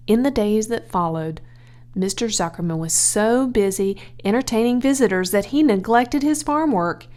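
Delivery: ordinary delivery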